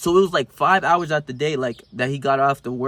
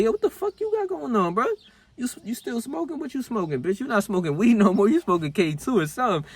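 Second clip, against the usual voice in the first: clown on him in a high cartoon voice; mocking roast; 8 semitones higher than usual. Flaming himself in friend's voice.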